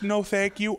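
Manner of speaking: light mock-polite refusal; polite brush-off. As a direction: wave them off without breaking stride. Reenacting own refusal.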